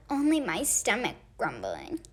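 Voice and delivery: usual speaking voice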